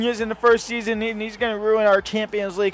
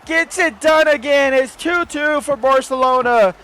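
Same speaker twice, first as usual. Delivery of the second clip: ecstatic celebration; 9 semitones higher, 6 dB louder than usual. Real, not acted. Gamer celebrating an equaliser, loud high joy. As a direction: celebrate the comeback at full voice.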